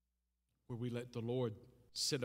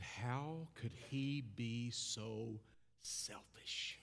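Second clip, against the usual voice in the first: hushed anguish; 3 semitones lower, 5 dB quieter, much slower than usual. Retold or imitated. Retells his anger at father.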